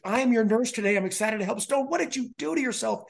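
high, bright-voiced cheerful nurse imitation; bubbly nurse. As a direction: play the chirpy sweet nurse greeting a kid. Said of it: "some sweet little bubbly gal".

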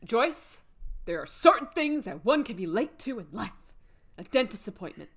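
theatrical quote, rising to an exasperated peak; quoted character rant. Retold or imitated. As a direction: read it out as a mock rant, swelling midway. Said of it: "let me get the quote".

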